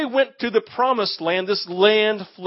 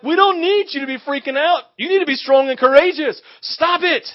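louder, higher mock telling-off; raised-voice impatient order; exasperated scolding; 6 semitones higher, 6 dB louder than usual. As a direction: scold him into toughening up. People scolding panicking Joshua.

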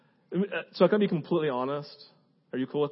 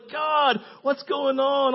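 higher, slow drawn-out cry to God; 7 semitones higher, much slower than usual. Retold or imitated. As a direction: stretch out the plea. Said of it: "all these places of like".

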